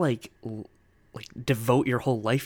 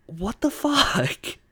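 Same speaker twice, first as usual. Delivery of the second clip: baffled what the fuck; 10 semitones higher, much slower than usual. Real, not acted. Baffled at the fan's reaction.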